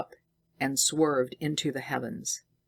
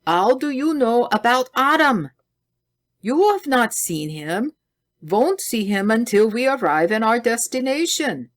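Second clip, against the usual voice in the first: put-on 1 alarmed German accent; 8 semitones higher, 5 dB louder than usual. A narrator's reading. Scientist alarmed about Adam.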